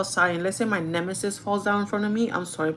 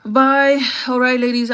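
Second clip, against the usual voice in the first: loud, high farewell; called-out goodbye; 5 semitones higher, 10 dB louder than usual. Acted. Streamer saying bye to the ladies, calling out loudly. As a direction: call it out across the room.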